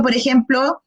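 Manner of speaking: ordinary talk, nothing marked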